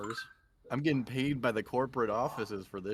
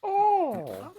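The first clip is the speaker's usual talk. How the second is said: delighted oh; 9 dB louder than usual. Real, not acted. Delighted reaction.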